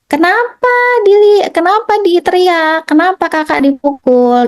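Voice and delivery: high, slow startled scolding of a mother; a mother's sudden alarmed 'why?', pitched high. Retold or imitated. Mother turning to her shouting baby, high startled scolding. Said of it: "bunda langsung lihat".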